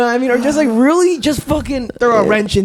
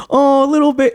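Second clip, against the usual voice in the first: a hint of doting mom, pitched higher; lightly voiced mom; 7 semitones higher than usual. Son retells mom interrupting his chat with girls, lightly voiced mom. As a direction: imitate your mom, a little high. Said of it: "my mom would come up".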